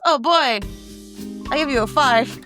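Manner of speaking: ordinary talk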